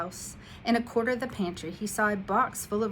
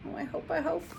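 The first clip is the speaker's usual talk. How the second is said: hopeful aside; 9 semitones higher, faster than usual. Real, not acted.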